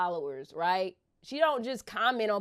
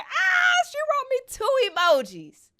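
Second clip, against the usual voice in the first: high, loud shriek of joy; squealing with delight; excited scream; 6 dB louder than usual. Retold or imitated. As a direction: scream it out, overwhelmed fan. Screaming at idol's emojis.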